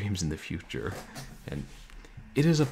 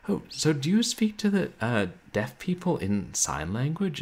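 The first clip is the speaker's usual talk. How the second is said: lightly voiced curious viewer, pitched up; imitating a viewer; 6 semitones higher than usual. Viewer asks a streamer about sign language, imitating a viewer. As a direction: ask it as a naive stream visitor would. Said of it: "somebody came into her, uh, like, stream and said".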